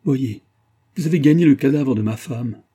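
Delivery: husband accusing the colonel over his wife's body, terrible cry. A narrator's reading. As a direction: hurl it at the colonel in horror. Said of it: "s'écria de nouveau d'une voix terrible".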